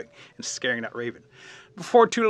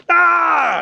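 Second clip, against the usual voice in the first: loud high-pitched scream; 14 dB louder than usual. A narrator's reading. Raccoon hit in the face by the fire, acted scream. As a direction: scream out as the story's startled raccoon. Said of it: "And he screams".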